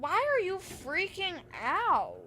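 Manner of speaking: exasperated. Retold or imitated. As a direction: drag it out like you can't believe them. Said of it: "I obviously didn't say that".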